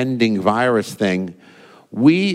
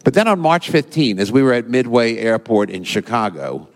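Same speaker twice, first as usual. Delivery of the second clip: ordinary talk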